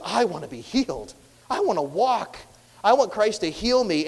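impassioned cry of wanting; urgent longing. Acted. Voices sick man's insistent longing.

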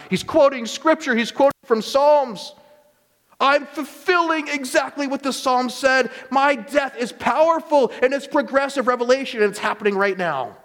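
high, passionate emphasis; fervent preaching. Real, not acted. Preacher answering mockers online, fervent and high. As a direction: press the point home with fire.

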